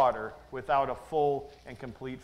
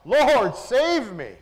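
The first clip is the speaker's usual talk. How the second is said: crying out for help; 4 semitones higher, 5 dB louder, much slower than usual. Acted. Peter sinking, cries out.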